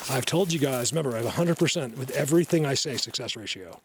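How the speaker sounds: ordinary talk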